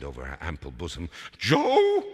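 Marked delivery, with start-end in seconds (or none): 1.4-2.0 high, loud, drawn-out wail; grief-struck cry on one long word. A narrator's reading. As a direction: wail the name in shock. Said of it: "she wailed".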